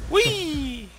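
ordinary talk, nothing marked

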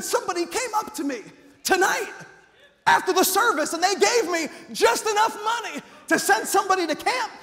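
high, loud, eager announcement; bursting to tell the boy something; lit-up bus captain; excited news. Retold or imitated.

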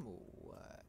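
soft whisper to himself. Real, not acted. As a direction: breathe the words, barely voiced. Quiet asides while exploring.

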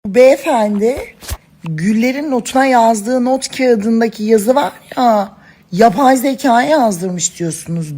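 ordinary talk, nothing marked

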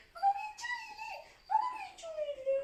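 mother voicing her baby's reply in a tiny high voice. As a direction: speak as the crying baby would. Mother voicing baby in squeaky voice.

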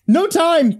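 high, urgent yell; high-pitched girl shouting; girl's yell. A narrator's reading. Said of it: "the girl yelled".

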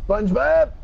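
loud, high cartoon shout. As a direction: call out the name loudly.

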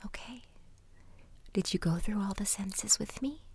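soft unvoiced whisper, slow and close; ASMR whisper. Listener guided through the senses, in a whisper. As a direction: whisper gently into the listener's ear.

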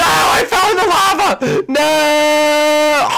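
high, loud wail of dismay; anguished shout. Real, not acted. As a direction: cry out falling in lava. Falls in lava.

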